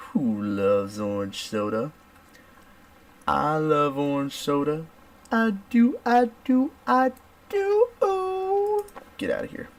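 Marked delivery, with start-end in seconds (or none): 0.1-1.9 sitcom catchphrase question. Retold or imitated. Quoting favorite show's call and response. 3.3-4.9 high, slow sustained answer; sitcom catchphrase answer. Retold or imitated. Quoting favorite show's call and response. 5.3-8.9 very high, climbing squeals; excited sitcom catchphrase. Retold or imitated. Finishing the favorite show's catchphrase.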